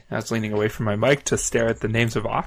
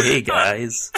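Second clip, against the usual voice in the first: normal talking voice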